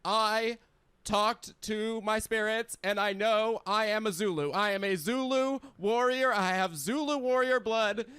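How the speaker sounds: Oprah. Retold or imitated. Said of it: "She's like".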